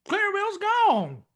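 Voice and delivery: startled alarm; alarmed discovery. Acted. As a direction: blurt it out as you find her missing. Discovers captive escaped.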